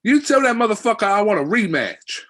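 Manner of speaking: big brash New York American accent, hammed up; Kevin Kelly's accent. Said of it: "And he's like".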